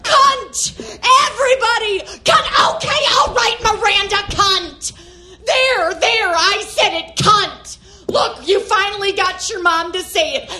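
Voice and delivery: high, frantic outburst; unhinged rage. Acted. Mother giving in to Miranda in the theater, high manic rage. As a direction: snap and let the forbidden word fly, high and forceful. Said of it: "unconscious rage".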